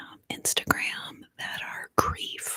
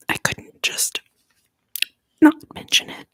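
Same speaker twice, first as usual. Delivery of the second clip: tearful strained whisper, louder on "not"; choked up; 10 dB louder than usual. Real, not acted. To a viewer whose comment moved her, choking up. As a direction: push "not mention it" out through tears. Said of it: "I've gotten a bit emotional".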